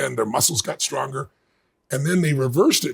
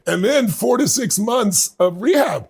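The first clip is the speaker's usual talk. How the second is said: laughing; 8 semitones higher, 5 dB louder than usual. Real, not acted. Laughing about one-armed stage show.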